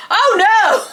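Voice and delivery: high-pitched retold "uh-oh" dismay; mock dismay. Vlogger retelling the clerk seeing the picture, mock dismay. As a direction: act out a shocked discovery, voice jumping up.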